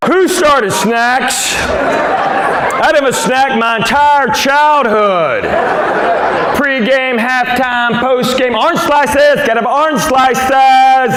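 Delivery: high, slow, indignant rant; incredulous rant. Acted. Comic ranting to the crowd about snacks, high and incredulous. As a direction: squeal the outrage about orange slices.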